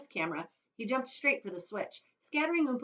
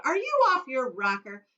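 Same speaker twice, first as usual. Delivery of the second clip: loud, higher outburst; exasperated cry; 8 semitones higher, 9 dB louder than usual. Acted. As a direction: scold him loudly. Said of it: "cried Mister Wonka".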